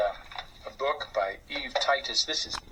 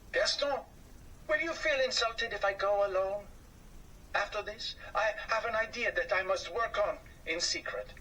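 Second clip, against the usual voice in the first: lightly voiced Anatole; 7 semitones higher than usual. Anatole voiced asking friend.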